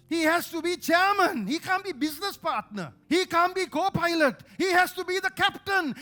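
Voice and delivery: loud, high insistence; forceful preaching. Real, not acted. Preacher to church, loud high insistence. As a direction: pound each claim.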